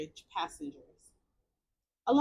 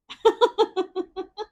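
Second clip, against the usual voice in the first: loud high laugh; 8 semitones higher, 7 dB louder than usual. Real, not acted. Streamer laughing after praising tattooed men, loud laugh. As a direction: burst out laughing. Amused at own confession.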